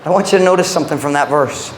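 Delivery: normal talking voice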